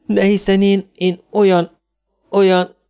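high, faltering protest; choked up, halting and high-pitched; voice breaking. A narrator's reading. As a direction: stammer upward until the voice gives out. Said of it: "A hangja elcsuklott".

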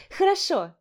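high, bright bragging "хорошо"; proud boast. Acted. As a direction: show off your success. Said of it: "похвасталась я".

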